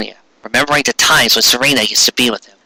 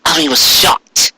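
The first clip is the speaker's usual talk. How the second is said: ordinary delivery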